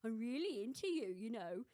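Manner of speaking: a hint of a mock Birmingham accent; lightly put-on Brummie lad. Holiday guy flirting, in a light mock Birmingham accent. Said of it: "that sounded a bit Scouse".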